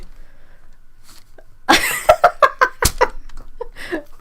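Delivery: silent wheezing laughter, high; helpless laughter that runs out of voice; long breathless laugh. Real, not acted. After reading Slayer, long wheezing laugh.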